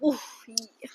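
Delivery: breathless whispered reaction; whispered oof. Real, not acted. Reacting to a fierce book scene, whispered. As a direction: whisper it like you felt the punch.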